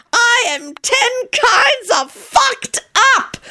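very high, slow, giddy near-shrieking; giddy euphoria. A narrator's reading. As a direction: float away in mad delight. John delirious.

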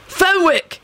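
high calling-out at normal volume; high-pitched yell, little volume. A narrator's reading. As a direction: call the name out high. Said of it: "he yelled".

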